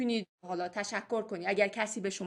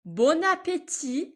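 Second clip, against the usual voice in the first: Bon appétit slowly with the liaison, higher and louder; slow model take; 7 semitones higher, 4 dB louder, much slower than usual. Shown as a demonstration. Teacher models the liaison slowly for students. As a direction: draw it out, linking bon into appétit. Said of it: "اینجا لیزون خواهیم داشت".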